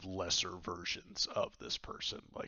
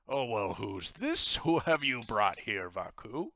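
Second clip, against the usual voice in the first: loud, slow pirate asking; curious pirate captain; 3 semitones higher, 7 dB louder than usual. Acted. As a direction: size up the stranger boldly. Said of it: "and he says".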